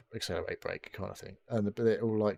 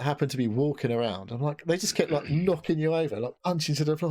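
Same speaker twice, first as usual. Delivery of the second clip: normal talking voice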